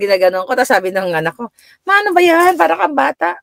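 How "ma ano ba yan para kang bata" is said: put-on high, annoyed child's complaint; mock teenage whine; whiny grown child. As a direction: act the embarrassed son.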